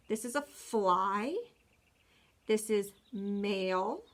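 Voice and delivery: slow, careful naming, each line rising at the end; name each picture slowly for the class. Shown as a demonstration.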